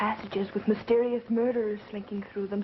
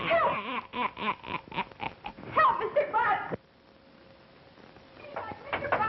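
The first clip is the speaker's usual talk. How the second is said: panicked cry for help; 13 semitones higher than usual. Acted. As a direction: scream out for rescue. Frightened woman calls for rescue.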